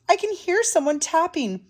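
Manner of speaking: high, small worried mouse voice; little mouse. A narrator's reading. Little Mouse reporting tapping to Big Mouse, high voice. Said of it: "called Little Mouse".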